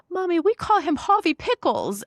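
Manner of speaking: high little toddler voice, put on; imitating two-year-old. Toddler naming the new puppy to mom, imitated high little-kid voice. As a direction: do a toddler's high-pitched announcement. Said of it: "So she's two".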